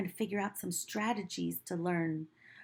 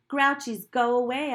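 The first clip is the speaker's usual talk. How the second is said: louder, firm command to go away; firm call; 4 semitones higher, 9 dB louder than usual. Acted. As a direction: shoo the Grouchies off. Boy in story orders Grouchies off.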